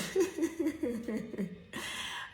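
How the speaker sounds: a high, amused burst of laughter at herself. Real, not acted. Vlogger laughing about crying at the airport, high amused laugh. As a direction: laugh at her own tears.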